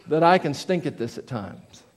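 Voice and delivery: normal talking voice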